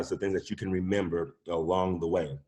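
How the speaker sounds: ordinary talk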